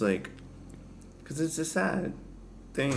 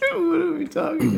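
laughing; 5 dB louder than usual. Real, not acted. Laughing at own rambling.

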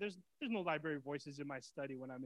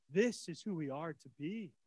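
normal talking voice

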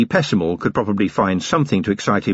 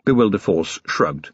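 ordinary delivery, nothing marked

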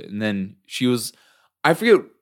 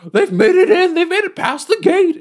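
very high, louder alarmed goblin cry; panicked goblin; 5 dB louder than usual. Retold or imitated. Goblin running to Jareth on his throne, very high panicked cry. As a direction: shriek the news like a frantic goblin.

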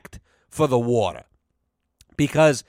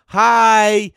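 higher, louder smug little greeting; mock cheery hi; 6 semitones higher, 6 dB louder than usual. Waving from the boat at the doomed swimmer, smug cheery hi. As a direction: call out a cheeky hi from a safe distance.

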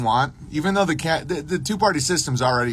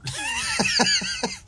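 high shrieking burst of laughter; real high-pitched cackle; 4 dB quieter than usual. Real, not acted. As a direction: crack up at your own joke. Laughing at his own bit.

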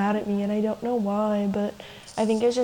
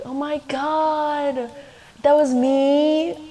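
higher and slow, gasping in amazement; starstruck disbelief; 5 semitones higher, 4 dB louder, much slower than usual. Retold or imitated. Learning her crush was actually me, slow higher starstruck surprise. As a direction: stretch it out, stunned it was you. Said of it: "like, in starstruck".

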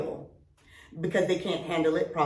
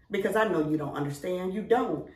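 normal talking voice

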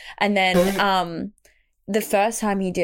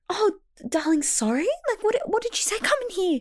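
much higher, cooing nurse voice; imitated concerned nurse; 12 semitones higher than usual. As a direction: play a nurse straining to understand a patient.

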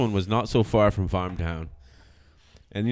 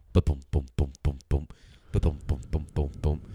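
1 imitated thumping; 7 semitones lower, 3 dB quieter than usual.

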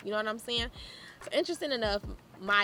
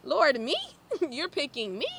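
high and louder, laughing in wonder; can't believe she's the chosen one; giddy disbelief; 5 semitones higher, 3 dB louder than usual. Retold or imitated. Asking the Lord if he's really picking her, giddy. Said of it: "I said".

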